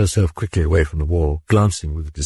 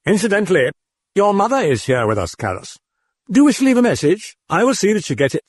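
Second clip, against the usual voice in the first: high, fast taunting demon; faster than usual. Acted. Demon taunts Karras about his mother.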